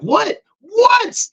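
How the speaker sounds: very high, rising what-what; excited squeal. Retold or imitated. Answering the bored assistant with a high excited squeal. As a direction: squeal it with glee. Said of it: "I'm like".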